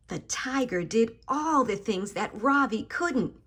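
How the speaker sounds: ordinary delivery, nothing marked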